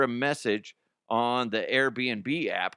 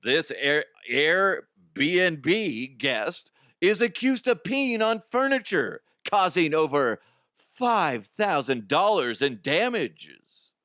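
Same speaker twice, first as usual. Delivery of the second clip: louder, higher announcer voice reading the headline; 5 semitones higher, 4 dB louder, much slower than usual. Acted. Don Pardo reading the headline to the audience, announcer style. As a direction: read it like a TV announcer.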